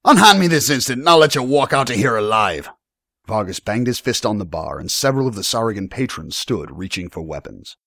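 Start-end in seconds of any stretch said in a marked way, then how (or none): 0.0-2.7 loud, high, threatening demand; blustering barman making demands; defiant tavern owner. Acted. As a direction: throw the threat back loudly.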